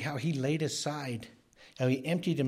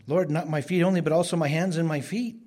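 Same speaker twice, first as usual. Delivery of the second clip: loud, high, insistent plea; acted-out impulsive outburst, louder and higher; over-eager plea; 6 semitones higher, 9 dB louder than usual. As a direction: beg eagerly at full voice, pitch raised. Simon swings to other extreme.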